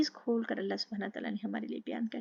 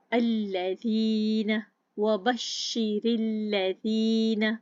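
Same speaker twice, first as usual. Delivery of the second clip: slow, louder recited word with doubled lam; tajweed demo; 6 dB louder, much slower than usual. Shown as a demonstration. Teacher demonstrates the doubled letter, slow recitation. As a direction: hold the shadda firmly, recite slowly. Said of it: "रा की जेर को लाम मुशद्दद के साथ पकड़ा".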